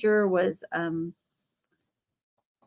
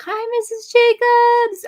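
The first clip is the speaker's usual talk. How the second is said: put-on high, slow, sing-song child's hello, louder; cheery kid greeting; 7 dB louder, slower than usual. Boys greeting their teacher at her door, high and sing-song. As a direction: chirp it like a proud little boy. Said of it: "and were like".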